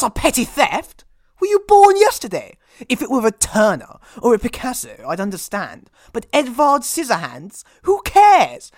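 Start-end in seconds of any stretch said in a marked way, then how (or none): 1.4-2.6 scornful. Acted. Scornful retort. 7.8-8.7 dismissive. Acted. Dismissive outburst.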